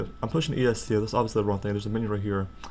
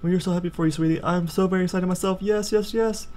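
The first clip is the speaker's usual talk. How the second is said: put-on high squealy cheer for the kid; over-the-top happy parent voice, high; cheering mom; 6 semitones higher than usual. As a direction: gush like a proud parent.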